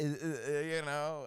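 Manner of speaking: ordinary talk, nothing marked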